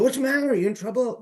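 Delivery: high-pitched alarmed question, imitated; retold worried question, voice jumping high; mock concern. Voicing worried colleagues' question.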